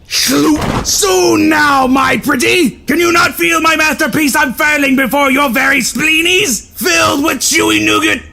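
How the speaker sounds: wild, shouted megalomaniac proclamations; manic ranting. Acted. Noodle Boy proclaiming to the crowd, manic shouting. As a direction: bellow nonsense like a mad emperor. Deranged hero rants at a crowd.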